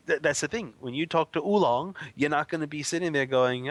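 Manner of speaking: ordinary talk, nothing marked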